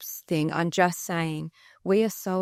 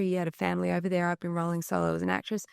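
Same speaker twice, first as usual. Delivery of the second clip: ordinary talk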